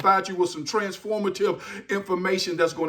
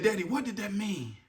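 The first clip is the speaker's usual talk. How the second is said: lightly voiced child; 9 dB quieter than usual. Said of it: "they said".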